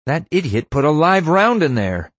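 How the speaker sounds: loud, high cry of alarm. A narrator's reading. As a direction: cry out an urgent warning, rising high. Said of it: "Erica cried".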